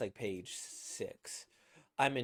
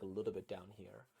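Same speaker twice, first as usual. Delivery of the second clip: soft, low chest voice; natural lower register; 3 semitones lower, 8 dB quieter than usual. Shown as a demonstration. Voice actor showing where their natural voice sits, low and soft. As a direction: let it sit down in the chest. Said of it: "when I speak naturally, it's kinda, like".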